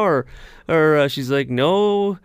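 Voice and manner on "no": high Southern woman's voice; a drawn-out Southern refusal; Southern accent. Retold or imitated. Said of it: "her southern accent".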